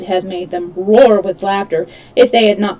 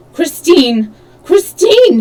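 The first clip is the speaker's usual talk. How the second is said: louder, higher cry building up; desperate calling; 7 semitones higher, 7 dB louder, slower than usual. A narrator's reading. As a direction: call into the dark pit. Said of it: "he moaned".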